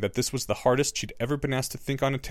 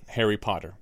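usual speaking voice

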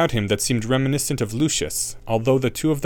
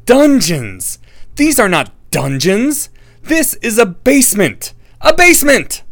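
outraged; 12 semitones higher, 10 dB louder, slower than usual. A narrator's reading. Harry outraged about dungeons.

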